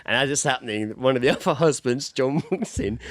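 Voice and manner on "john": ordinary delivery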